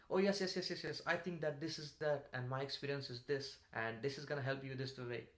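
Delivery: high, loud, rushing yes-yes-yes; eager rushed answer. Shown as a demonstration. Job candidate rushing to answer the interviewer, overeager. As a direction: blurt the answer before they finish. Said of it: "somebody who just started with".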